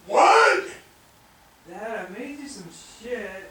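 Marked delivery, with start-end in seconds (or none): 0.1-0.6 sudden loud high yell; exasperated shout. Acted.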